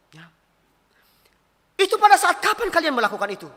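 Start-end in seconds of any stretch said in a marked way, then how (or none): 1.8-3.5 high-pitched startled question; retold shock. Pastor questioning a young couple's timing, re-enacted shock. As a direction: ask it taken aback, pitch jumping up. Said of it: "Lebih kaget lagi saya".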